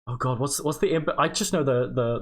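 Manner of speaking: ordinary talk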